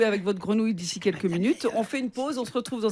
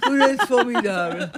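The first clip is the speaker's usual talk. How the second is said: laughing showman voice, louder; hammed-up TV host praise; Jacques Martin impression; 2 semitones higher, 6 dB louder than usual. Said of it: "l'école des fans de Jacques Martin".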